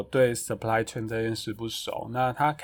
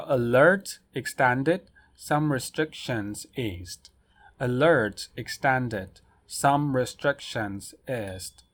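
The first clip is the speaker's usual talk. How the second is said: slow headline read; slower than usual. Shown as a demonstration. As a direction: slowly read news headline. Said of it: "然后再来下一个新闻".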